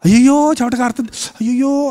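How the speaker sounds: light, mock fretting over sweat; mock dismay. Preacher mimics someone fretting about sweating, mock dismay. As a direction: imitate a fussy little lament.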